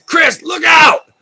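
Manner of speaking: high, drawn-out yell of warning; retold warning shout. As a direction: shout the warning to a friend across the pond. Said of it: "he says".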